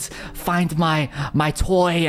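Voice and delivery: lightly imitated possessed actor, halting; possessed man. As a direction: start with a high eerie cry, then drop to a whispery plea. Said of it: "he was all possessed".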